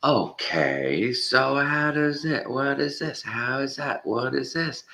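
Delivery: high, slow, mocking questioning voice; mimicking the skeptic. Mocking the flat earther debater's questions, high and slow. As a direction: play dumb and high-pitched. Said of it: "this guy going".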